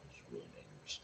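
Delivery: whispered aside. Real, not acted. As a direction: whisper it conspiratorially. Aside about sugar-free drinks.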